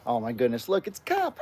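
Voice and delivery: ordinary talk, nothing marked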